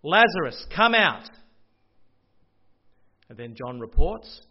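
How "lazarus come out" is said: loud, higher call to the tomb. Acted. Jesus at the tomb calling Lazarus, loud command. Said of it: "calls out in a loud voice".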